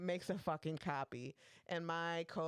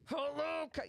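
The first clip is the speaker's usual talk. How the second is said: higher, louder, impatient hello; mock angry greeting; 10 semitones higher, 3 dB louder than usual. Coworker snaps at the copier, mock angry. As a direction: bark it at the machine, mock annoyed. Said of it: "getting angrier and angrier".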